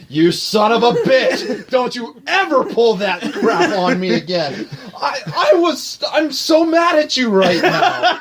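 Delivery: high-pitched retold scolding, half laughing; mock outrage. Pranked friend telling off the prankster, high mock outrage. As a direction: imitate a pranked friend blowing up, amused.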